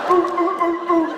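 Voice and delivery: high barking euh like a seal; sea lion bark. Acted. Leader imitating the scout's seal noises, high barks. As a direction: yap out each euh like a circus seal. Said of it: "c'est pas la peine de faire l'otarie".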